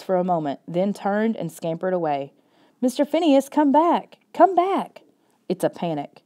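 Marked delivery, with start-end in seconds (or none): 2.8-5.0 frantic shouting. Acted. Boy chasing his runaway dog, shouting and begging in panic. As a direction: shout and beg the dog to come back. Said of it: "shouting, begging him to stop".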